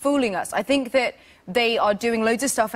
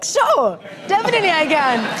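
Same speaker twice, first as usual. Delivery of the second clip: higher, playfully over-keen reply; mock eager agreement; 4 semitones higher than usual. Actress retelling her reply to fans, mock eager. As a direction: put on an obliging yes.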